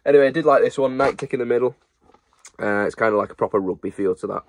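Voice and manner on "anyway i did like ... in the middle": ordinary delivery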